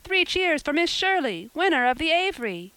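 high, loud cheer; rally the hall with a cheer; calling out. A narrator's reading. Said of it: "somebody called out".

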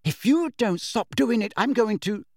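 high, worked-up warning; threatening. A narrator's reading. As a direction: threaten urgently until cut off. Hermione threatening, gets cut off.

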